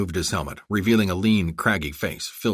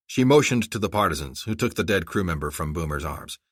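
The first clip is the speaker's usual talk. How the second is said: usual speaking voice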